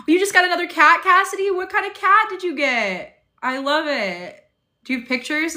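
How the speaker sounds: thrilled to hear about a new cat; excited. Real, not acted. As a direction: burst out with delight at the news. Reacting excitedly to viewer's news.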